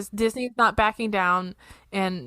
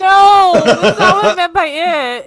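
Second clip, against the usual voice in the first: high, very loud objection; loud protest; 10 semitones higher, 11 dB louder than usual. Real, not acted. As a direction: cry out in protest at a joke. Co-host's pension joke provokes loud protest.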